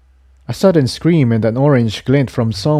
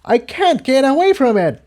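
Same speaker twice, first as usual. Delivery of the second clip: panicked. Acted. Incendia trapped by plant.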